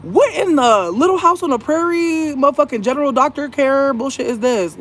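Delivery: high, outraged sass; shrill-edged disbelief, fired back at him; exasperated retort. Retold or imitated. Patient snapping back at the doctor in high outrage. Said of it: "I'm like".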